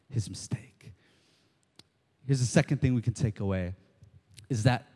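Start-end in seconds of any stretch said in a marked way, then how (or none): 0.1-0.9 whispered. Acted.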